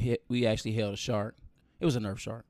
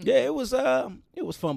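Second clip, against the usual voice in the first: high voice; 6 dB louder than usual. Acted. High voice after accent joke.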